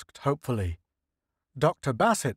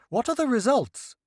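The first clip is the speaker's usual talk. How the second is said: lightly raised voice of an eager official; a hint of a higher, hopeful leader; Senate leader voice; 11 semitones higher than usual. Senate leader asks scientists about the alien ship, lightly raised voice.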